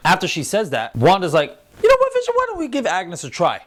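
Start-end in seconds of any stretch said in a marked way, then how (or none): 1.8-3.6 high-pitched put-on woman's voice; Wanda. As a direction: imitate a wife piping up. Acted suggestion as Wanda.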